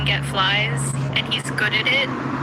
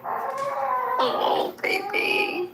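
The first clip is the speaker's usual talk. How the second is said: very high, soft coo; 3 dB quieter, much slower than usual. Real, not acted. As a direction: coo sweetly over a pet. Cooing over an animal.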